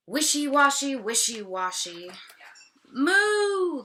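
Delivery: loud, high lowing Moo; cow crying out. Acted. Teacher voices the cow at the tub, crying out. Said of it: "the cow cries".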